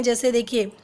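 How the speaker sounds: ordinary talk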